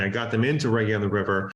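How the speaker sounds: ordinary delivery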